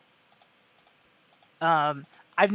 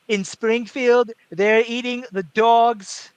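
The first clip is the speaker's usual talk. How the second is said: slow, higher-pitched Trump, drawn-out words; Trump impression; 4 semitones higher, 7 dB louder, much slower than usual. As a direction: stretch "dogs" and "cats" like Trump on a rant. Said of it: "I love the way he says dog".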